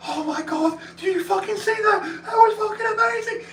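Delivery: hyped fan shouting at a stunning goal; excited shout. Retold or imitated. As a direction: cheer like a fan at a great goal. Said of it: "we'd be like".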